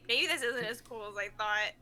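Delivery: low, soft letdown; quietly disappointed, voice sunk; deflated. Acted. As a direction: let the excitement drain right out. Deflated after the blade fails.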